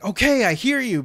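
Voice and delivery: high-pitched exasperated retort; retold irritation. Man answering the endless knocking, exasperated. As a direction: call back fed up, pitch jumping up. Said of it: "So I'm really pissed off".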